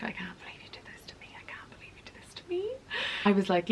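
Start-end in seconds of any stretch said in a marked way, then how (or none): 0.0-1.3 whispered accusation, fast and breathless; hissed whisper. Retold or imitated. To her friend back home, a fast accusing whisper. 1.4-2.7 whispered again, pitched much higher. Retold or imitated. To her friend back home, a high, fast accusing whisper.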